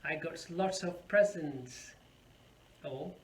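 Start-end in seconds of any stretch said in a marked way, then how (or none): 0.0-1.9 presents said PREZ-ents, slowly; slow example sentence, stressing the first syllable of presents. Shown as a demonstration. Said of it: "on the first syllable of the word".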